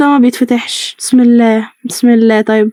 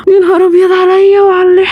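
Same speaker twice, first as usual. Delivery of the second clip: frightened wail; 6 semitones higher than usual. Real, not acted. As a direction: wail as if crying after a scare. Wailing right after the scare.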